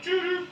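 high loud robot beeping; robot beeps. Retold or imitated. Robot maid beeping as she rolls, high and loud. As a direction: beep like a cartoon robot.

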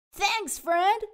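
high, loud thanks; grateful yell. Acted. Captain thanks the sea monster, loud call. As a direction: call out thanks across the water. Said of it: "yells Captain Pete".

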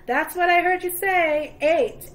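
teasing. Acted. Auctioneer teasing Jack loudly.

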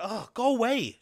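imagine a woman disgusted by an advance; grossed out. Acted. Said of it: "It must really be gross".

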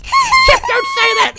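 very high, loud squeal; squeaky frantic yell; panicked scolding. Pretend. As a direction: shriek it in mock panic. Panicked reply to talk of crashing.

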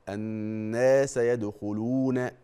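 الناس and يدخلون with long vowels held; careful tajweed reading; slow recited madd. Shown as a demonstration. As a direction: hold each madd vowel for two beats. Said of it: "شوف".

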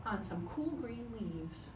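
ordinary delivery, nothing marked

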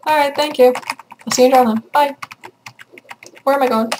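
normal talking voice

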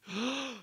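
high, loud gasp of recognition; sharp gasp. Retold or imitated. Mary hears her name and gasps in shock. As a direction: gasp as if suddenly knowing.

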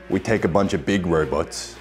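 ordinary talk

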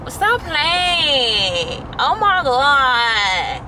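exaggerated, slow JT accent imitation; JT's accent. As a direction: stretch it out in a mock JT accent. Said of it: "I really love J T's accent".

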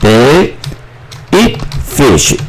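"they eat fish" split into separate slow words; word-by-word dictation. Shown as a demonstration. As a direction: pause between each word for learners. (一字一顿地念)

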